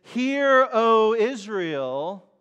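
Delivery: "Hear" rings out loud and high, then "O Israel" is drawn out slowly; proclaiming Moses. Retold or imitated. Moses calling out to Israel, loud slow proclamation. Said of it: "When Moses says".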